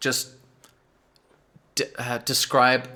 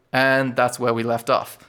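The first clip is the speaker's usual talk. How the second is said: ordinary delivery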